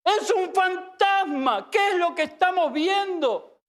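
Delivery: high, slow panicked cry; terrified cry. Acted. Frightened disciples cry out in the storm, high panicked shout. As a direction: shout it out in fear, stretching the words. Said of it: "llenos de miedo comenzaron a gritar".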